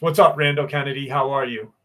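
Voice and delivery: usual speaking voice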